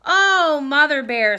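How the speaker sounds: high, loud gasp of a greeting; delighted surprise. Acted. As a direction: exclaim like an amazed cub. Little Bear sees the surprise cake.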